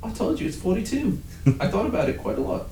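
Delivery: high-pitched British computer voice; British accent. Acted. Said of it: "That's my British accent".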